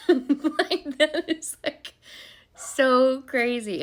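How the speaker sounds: laughing, then stretching out "so crazy" slowly; amused disbelief with a laugh. Real, not acted. Laughing disbelief at his secret.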